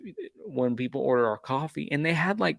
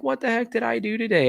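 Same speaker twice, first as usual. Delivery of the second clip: pitched way up in disbelief; a high, incredulous what-the-heck; exasperated disbelief; 10 semitones higher than usual. Retold or imitated. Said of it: "I'm like".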